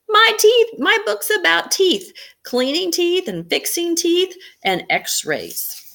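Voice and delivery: put-on high, loud little girl gushing; excited child. Amy telling the magician about her teeth book, high excited child. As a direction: gush like a thrilled kid.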